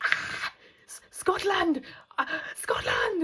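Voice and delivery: high, eager stumbling over the word; a thrilled foreigner groping for the name; excited German. Retold or imitated. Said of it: "he was like".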